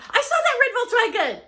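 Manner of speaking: high, loud excited blurt; enthusiastic talker. Retold or imitated. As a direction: burst out with the story. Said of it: "if you're going".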